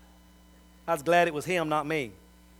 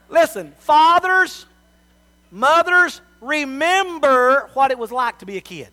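loud, higher, slow call to the parents; preaching appeal; 7 semitones higher, 9 dB louder, slower than usual. Real, not acted. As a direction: plead with them from the pulpit, full force.

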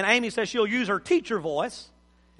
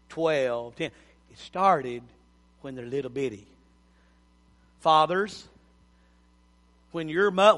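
ordinary talk, nothing marked

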